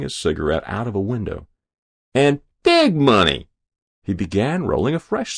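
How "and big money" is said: high, loud insistence; greedy lout. A narrator's reading. Hero telling officials his terms, greedily. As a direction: hammer it home in a brash high voice.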